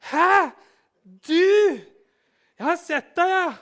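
very high, loud startled huh; shocked disbelief. Acted. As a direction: caught out, you too?. Mocking reply in modern scene. (sjokkert, høyt hæ)

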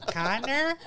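put-on high, bright-eyed kid voice on Connor?; a small child sounding out Connor?; little kid. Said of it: "I'm always like".

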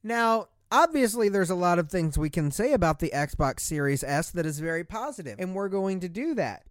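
ordinary delivery

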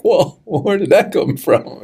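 high-pitched, laughing double take at something shocking; mock gasp of surprise, laughing it off; startled whoa. Speaker recalling seeing someone mistreated, in a laughing, surprised voice. Reacting to someone treated poorly.

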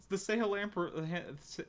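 stumbling softly over a hard word; falter, restart, never finish the word; stuttering. Real, not acted. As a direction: trip over the syllables and trail off. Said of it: "You were, like, stuttering".

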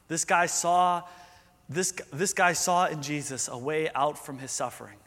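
usual speaking voice

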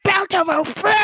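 high-pitched, unhinged shouted imitation; psychotic scream. Co-host imitates the flamethrower man's line, psychotic scream. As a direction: scream the pun like a madman. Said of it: "He screams it, like, in the most psychotic way".

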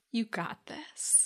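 soft, slow reassuring murmur; calm her down kindly; gentle encouragement. Retold or imitated. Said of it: "he was just like".

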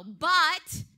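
BUT punched very loud; "But" as one booming, isolated word; big emphatic word. Acted. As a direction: hit "But" like a heavy warning. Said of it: "here's that big word".

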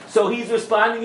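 ordinary talk

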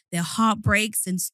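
usual speaking voice

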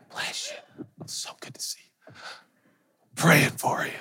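whispered, sugary blessings; whispered mock-pious greeting; holy whisper. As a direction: breathe it out like a too-sweet saint.

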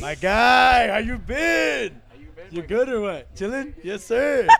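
high, loud call across the room, stretched slow; shouting hello to a friend walking in; loud greeting. Real, not acted. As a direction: hail a buddy from a distance. Greeting a friend who just arrived.

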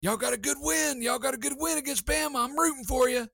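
high, loud mock fan yelling; hammed-up stadium cheering; fans screaming. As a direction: holler it like a crowd of excited fans. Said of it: "you just hear people screaming".

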